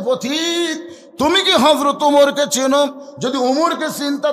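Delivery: acted high-pitched scolding; indignant challenge. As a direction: challenge the stranger in a high preaching voice. Shepherd rebuking traveller, acted.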